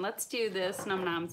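normal talking voice

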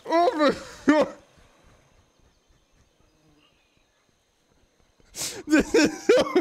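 high, squeaky words breaking into laughs; helpless laughter. Real, not acted. Player cracking up at creature, helpless laughter. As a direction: struggle to speak while cracking up.

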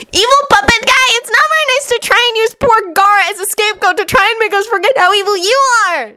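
put-on very high-pitched childish scolding; rebuking. A narrator's reading. Naruto scolds the evil puppet guy, high and reproving. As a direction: tell him off in a high, indignant voice. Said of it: "Naruto rebuked".

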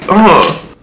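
loud, short ugh; a loud grunt of exasperation; exasperated ugh. Real, not acted. Stumbled over the gel's name, exasperated ugh.

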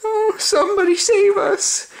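shrieking. Acted. Dogs in the pond crying for rescue, high shriek. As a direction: shriek for help like scared puppies. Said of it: "shrieked the dogs".

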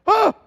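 sudden high, very loud shriek; panicked scream. Retold or imitated. Boy seeing the weed eater catch fire, shrieking. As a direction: let out a startled scream. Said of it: "And I go".

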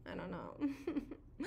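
real tearful break, soft and slow; crying. Real, not acted. Streamer tears up live over her sore eyes, crying softly. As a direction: let the tears catch the words. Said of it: "I'm just crying".

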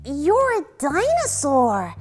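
disbelieving wonder. A narrator's reading. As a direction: say it in astonishment as a little boy. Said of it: "not quite believing his eyes".

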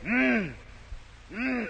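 faint dying moan, barely voiced; weak moan. Dying man moaning from his bed, a faint moan. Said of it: "had the blanket in his hand going".

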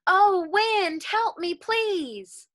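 a little rooster's mock cry for help; calling out for help. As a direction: call up to the wind, pleading. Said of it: "he called out".

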